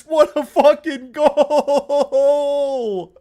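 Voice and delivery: loud, stretched, crying with happiness; overwhelmed by the goal; tearful joy. Real, not acted. Streamer to chat after the goal, crying with joy.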